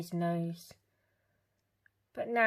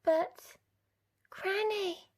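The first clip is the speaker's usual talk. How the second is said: put-on little girl; 14 semitones higher, slower than usual. A narrator's reading. Little Red's voice.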